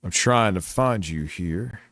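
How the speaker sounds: ordinary talk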